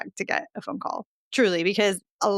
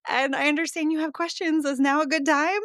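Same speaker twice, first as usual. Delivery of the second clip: mock caller voice; 9 semitones higher than usual. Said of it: "And you were like".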